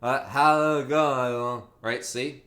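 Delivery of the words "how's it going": talking with tongue stuck out. Shown as a demonstration. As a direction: say it with your tongue hanging out. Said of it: "try speaking with your ton out".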